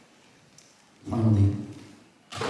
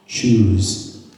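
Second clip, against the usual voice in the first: a firm, clipped order to choose; stern demand; stern command; 3 dB louder than usual. Retold or imitated. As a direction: press her hard with one word. Rabbi demands the mother choose.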